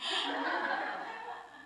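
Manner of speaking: breathy laugh through tears; tearful laugh. Real, not acted. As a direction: laugh away the crying. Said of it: "it's so annoying".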